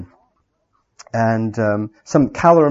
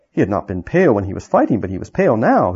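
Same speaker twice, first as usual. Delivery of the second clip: usual speaking voice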